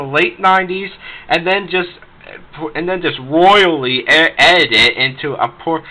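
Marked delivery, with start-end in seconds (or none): none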